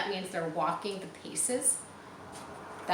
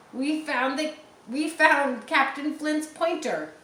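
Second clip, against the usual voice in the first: higher and louder pirate voice, slow; pirate proclaiming a discovery; excited pirate; 8 semitones higher, 5 dB louder than usual. Acted. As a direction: announce the find like a thrilled buccaneer. Long John announces the discovery.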